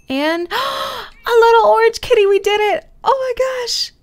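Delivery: high, loud gasping delight; thrilled gasp. Real, not acted. Gamer seeing the new kitten born, squealing.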